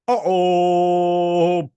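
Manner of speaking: big, loud cartoon uh-oh, held out; loud mock uh-oh. Villain about to fight, with a loud hammed-up uh-oh. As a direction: play it like a hammy villain relishing trouble.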